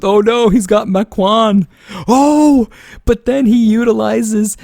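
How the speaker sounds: high, loud startled "oh no" at the pick; surprised reaction. Real, not acted. Caster sees the opponent's pick, surprised high and loud. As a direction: gasp out the surprise as the pick appears.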